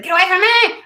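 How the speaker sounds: high, loud holler; retold holler. Oldest son hollering at little brother, loud shout. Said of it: "he was hollering".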